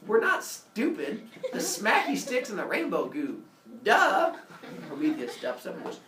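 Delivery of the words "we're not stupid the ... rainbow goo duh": scoffing. Acted. Humans scoff at Prometheus's question.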